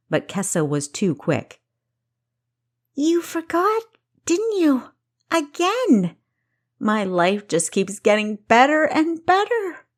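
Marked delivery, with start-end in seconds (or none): 2.9-9.9 much higher, loud hurt accusation. Acted. Kessa accusing her sister of forgetting her birthday, high and hurt. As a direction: throw the forgotten birthday in her face.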